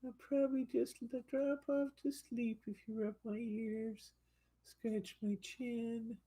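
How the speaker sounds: sleepy pleading pup; voicing the dog. Owner voicing the dozing poodle asking for ear rubs, high and soft.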